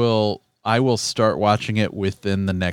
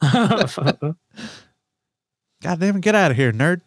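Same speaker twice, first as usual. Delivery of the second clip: mock Baby Billy; 6 semitones higher than usual. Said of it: "quoting show line".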